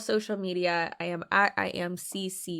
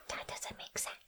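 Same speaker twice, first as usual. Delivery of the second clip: a soft breathy whisper to herself; whispered aside; 19 dB quieter than usual. Real, not acted. Mocking the caption, a quiet whispered aside. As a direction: drop to a secret whisper.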